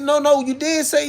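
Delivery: high-pitched acted insistence; retold arguing. Homie insisting during a retold argument, arguing.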